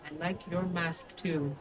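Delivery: put-on small, soft child voice; shy child. Child admiring a stranger's mask, soft and shy. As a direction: say it quietly, like a little kid. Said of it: "it's like".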